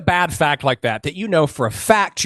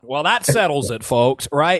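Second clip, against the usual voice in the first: normal talking voice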